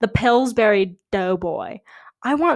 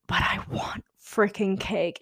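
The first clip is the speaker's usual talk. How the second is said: whispered, longing for cake; whispered craving; 5 dB quieter than usual. Real, not acted. Confessing her cake craving to viewers, whispered. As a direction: breathe it like a secret wish.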